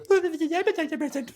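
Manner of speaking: very high-pitched silly retort; put-on squeaky gabble; mock babbling echo. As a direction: squeal it back as a cartoonish mock question. Mocking echo of wife's remark.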